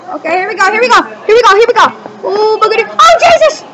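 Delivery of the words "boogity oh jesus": ordinary talk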